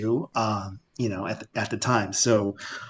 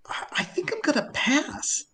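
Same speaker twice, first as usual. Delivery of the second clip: high, soft, slow and hesitant; reluctant refusal; 13 semitones higher, 5 dB quieter than usual. Retold or imitated. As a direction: back out gingerly, stretching the words. Teller hesitantly turns down the offer.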